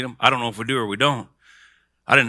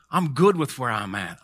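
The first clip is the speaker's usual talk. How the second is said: normal talking voice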